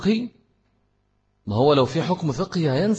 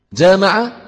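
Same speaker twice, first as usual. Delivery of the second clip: normal talking voice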